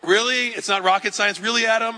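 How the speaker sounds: wife teasingly scolding Adam over his burger remark; exasperated wife. Retold or imitated. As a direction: call him out like a fed-up spouse.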